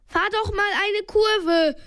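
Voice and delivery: high-pitched called advice; storyteller's lifted shout, no extra volume; calling out. A narrator's reading. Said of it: "rief Bob".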